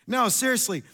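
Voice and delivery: usual speaking voice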